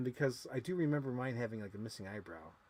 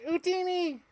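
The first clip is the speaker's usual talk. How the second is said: high squeaky Jawa yelp; Jawa cry; 6 dB louder than usual. Acted. Showing off a Jawa toy, high squeaky alien yelp. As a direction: chirp it out like a tiny Jawa.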